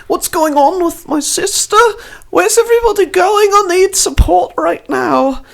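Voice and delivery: put-on high, loud, frantic young man; shrill-voiced high panicked plea for support; panicked brother. GM voicing JP, distressed brother.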